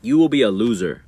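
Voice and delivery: ordinary delivery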